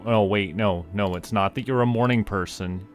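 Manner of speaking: ordinary talk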